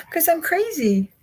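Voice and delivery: very high squeaky cartoon voice; squeaky silly voice. Silly self-mocking aside.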